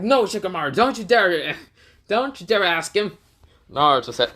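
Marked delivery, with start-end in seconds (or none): 0.0-3.2 high, urgent mock protest; alarmed warning. Ino warning Shikamaru not to ask, high alarmed protest. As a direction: forbid it, pitched up high.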